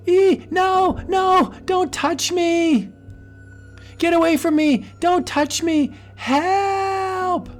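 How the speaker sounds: high shrieks of terror; screaming at the phantom to get away; terrified screaming. Acted. Donna screaming at the phantom face in terror.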